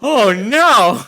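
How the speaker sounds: loud, high cry of horror; big hammed-up exclamation; mock dismay. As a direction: blurt it out in exaggerated alarm.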